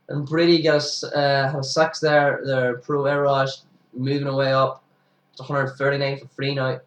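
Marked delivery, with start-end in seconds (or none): none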